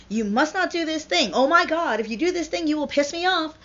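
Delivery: loud, high-pitched mock tirade; escalating parental outrage. Parent forbidding a teenager, loudly outraged. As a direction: scold a teenager, worked up and overdramatic.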